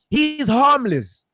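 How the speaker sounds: "He's harmless" high, loud and slow; emphatic insistence. Real, not acted. Driving home to co-hosts that he was harmless, emphatic.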